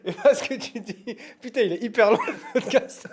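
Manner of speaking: high, loud words broken by laughter; real laughing fit. Real, not acted. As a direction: explain while cracking up, voice giving out. Pourquoi tu rigoles?. (en plein fou rire)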